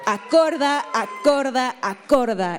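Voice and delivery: loud call fading into a falling tone; rally the crowd, then let it drop; shouted wake-up call. Acted. Band calls audience to wake.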